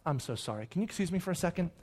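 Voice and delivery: dad quietly apologizing to his meeting; hushed apology. Retold or imitated. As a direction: mumble an apology to the room.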